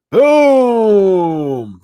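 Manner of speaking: big high shouted reveal; announcer-style boom; loud hype shout. Acted. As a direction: slam the word down like a reveal.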